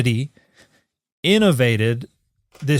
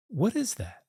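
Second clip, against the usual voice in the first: soft, high, puzzled peer into the distance; hushed, high-pitched wondering question; Bill Murray; 9 semitones higher, 10 dB quieter than usual. Retold or imitated. Retelling a comedy skit to a co-host, softly puzzled impression. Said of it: "looks off in the distance and goes".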